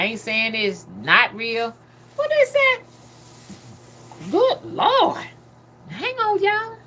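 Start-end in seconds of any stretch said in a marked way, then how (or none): 2.1-6.8 much higher, stunned disbelief; shocked. Real, not acted. Reading covid figures to the chat, shocked high exclamation. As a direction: react like the numbers just floored you. Shocked by what she reads.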